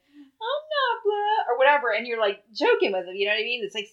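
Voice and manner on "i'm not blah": high, slow, whiny imitation of a little kid; mock a sulky little boy. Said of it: "he'd be like".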